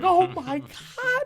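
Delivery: very high, drawn-out mock panic; panicking. Grandma seeing the mess she made, hammed-up panic. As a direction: squeal it slowly in comic horror. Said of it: "she started panicking".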